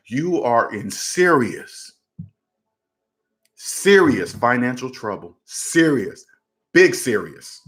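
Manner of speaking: "serious" drawn slow and repeated, set apart by pauses; grave emphasis. Real, not acted. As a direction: weigh down every "serious" as a warning. Warns about financial trouble.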